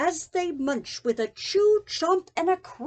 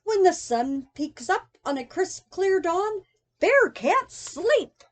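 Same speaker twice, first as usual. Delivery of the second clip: ordinary delivery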